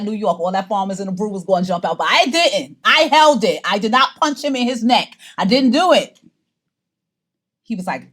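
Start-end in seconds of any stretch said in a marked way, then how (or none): 2.1-6.2 loud, high protest of restraint; emphatic insistence. Real, not acted. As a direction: punch out each denial, proud and loud. Loudly insisting on her restraint.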